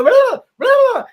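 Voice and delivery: high, loud comic blubbering cry; imitated noise. Fletch showing the cop what to do, imitated noise. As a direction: demonstrate the silly bug-clearing noise. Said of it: "And you have to go".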